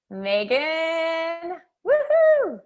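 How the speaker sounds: high, loud whoop; excited cheer. Real, not acted. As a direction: call her name and whoop. Cheering a giveaway winner.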